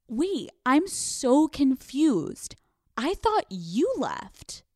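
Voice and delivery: slow, high-pitched, innocent act; feigned confusion. Acted. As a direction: draw it out, playing baffled and blameless. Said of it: "the lie begins to spin".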